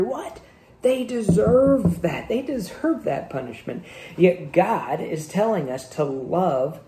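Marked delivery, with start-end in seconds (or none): 0.0-3.8 indignant anger. Acted. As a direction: act out offended disbelief, stretching the words. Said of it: "other times we are angry".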